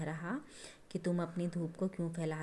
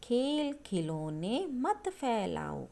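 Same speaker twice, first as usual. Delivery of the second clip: slow, drawn-out recital of the poem line; slow poem recitation; 3 semitones higher, slower than usual. Shown as a demonstration. Teacher reciting the poem line to her class, slow. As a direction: recite it slowly, like a poem for children.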